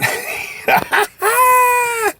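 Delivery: high, loud laugh; host's cheerful laugh. Real, not acted. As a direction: burst out laughing. Laugh at sign-off.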